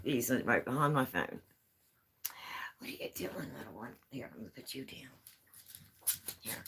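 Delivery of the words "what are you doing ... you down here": whispered to the little cat, softer; whispered pet talk. Real, not acted. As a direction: hush the kitten while moving it. Whispering to the kitten.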